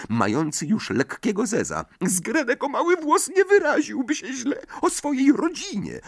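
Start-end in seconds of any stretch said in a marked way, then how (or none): 2.0-6.0 very high, earnest elf voice; a tiny servant fretting; squeaky house-elf. A narrator's reading. (piskliwy głos skrzata)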